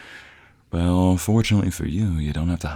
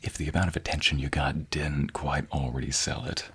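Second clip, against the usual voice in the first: confiding whisper; 3 semitones lower, 7 dB quieter than usual. Acted. Confiding aside to partner.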